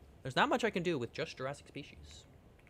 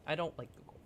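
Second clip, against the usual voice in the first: ordinary talk, nothing marked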